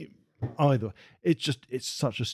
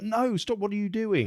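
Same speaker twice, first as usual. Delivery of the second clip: much higher, hammed-up alarmed protest; mock panicked Sarah Connor; 10 semitones higher than usual. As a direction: squeal it like a frantic mother stopping someone. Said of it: "It's like".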